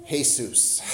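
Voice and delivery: "Jesus" said the Spanish way, hay-SOOS; Spanish pronunciation. Retold or imitated. The waiter gives his name, "Jesus" said hay-SOOS. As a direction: pronounce "Jesus" as hay-SOOS, with a falling end. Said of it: "Spanish for Jesus".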